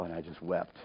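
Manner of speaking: normal talking voice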